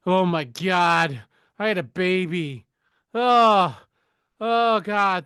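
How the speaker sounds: hammed-up panicking new mother; rising, wailing mock distress; mock panic. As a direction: play at being overwhelmed, drawing out the words.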